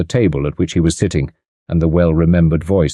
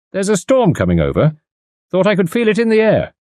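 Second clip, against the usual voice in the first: lightly voiced country mechanic, higher; the mechanic, sniffing the weather, a touch higher; a hint of a mechanic; 12 semitones higher than usual.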